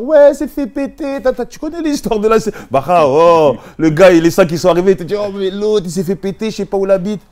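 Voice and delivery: high, loud hammed-up alarm; mock panicked report. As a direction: blurt it like a pal exaggerating bad news. Mimicking friends breathlessly reporting a bust.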